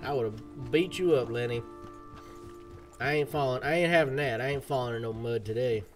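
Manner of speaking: banter like a cowboy on the trail; cowboy accent. Pretend. Streamer talking to Lenny and chat, cowboy accent. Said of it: "cowboy accent, it ain't too hard for me".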